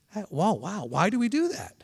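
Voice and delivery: soft, wondering "why do we do that?"; curious question. Shown as a demonstration. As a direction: ask in honest wonder, hushed. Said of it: "there's a difference between saying".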